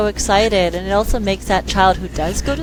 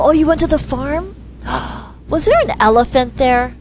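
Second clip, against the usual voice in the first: high, wide-eyed mock surprise with a gasp; mock amazement; 7 semitones higher than usual. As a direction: pretend to be amazed to draw her out. Playing dumb to the child.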